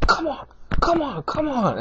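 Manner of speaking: high, strained mock taunt; beckoning a beaten opponent, mock. Anderson beckons a beaten opponent, high strained mock taunt. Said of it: "Anderson was like".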